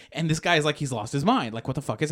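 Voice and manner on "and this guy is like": normal talking voice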